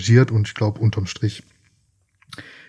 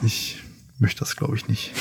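whispered aside; 9 dB quieter than usual. Real, not acted. Aside about parents' evenings.

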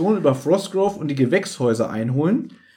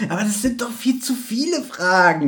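mock whining complaint, a bit higher; whiny Peter; 4 semitones higher than usual. Friend imitating Peter whining about too many questions. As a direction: whine it like a fed-up Peter. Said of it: "er jammert so ein bisschen so".

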